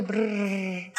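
long rolled "brrr"; imitated underwater rumble. Showing listener the noise in the other ear, imitated rumble. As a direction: trill out a sustained muffled-ear noise. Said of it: "como si estuviera debajo del agua".

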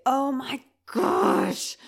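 loud, drawn-out exasperated groan of words; frustrated outburst. Retold or imitated. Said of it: "so frustrated".

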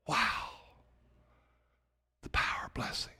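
the power of blessing breathed in awe; hushed awe. Real, not acted. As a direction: drop to a reverent whisper. Hushed awe after climax.

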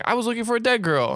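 high-pitched mock female voice; exasperated girlfriend. Jess answering her boyfriend Peter, high mock voice. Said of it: "And she's like".

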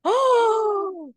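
loud high "Oh!"; startled exclamation; surprised gasp. Real, not acted. After gasp at sound.

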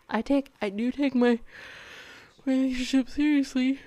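talking through a yawn; words stretched by a yawn. Real, not acted. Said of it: "sorry for the yawning".

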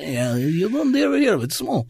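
playing a Russian, breaking into laughter; put-on Russian accent. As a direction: brush it off in a mock Russian accent. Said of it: "with a Russian accent".

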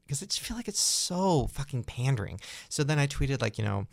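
ordinary talk

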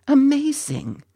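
high exclamation of wonder; amazed. A narrator's reading. Ginty marvels at the flowers.

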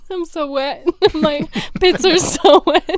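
giggling through the words; laughing. Acted. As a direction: laugh it out, silly.

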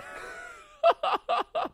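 real high-pitched cackle; high laugh. Real, not acted. Comedian cracking up at his own bit, high laugh. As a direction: burst into a long, very high laugh.

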